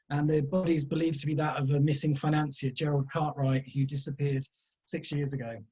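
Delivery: ordinary delivery, nothing marked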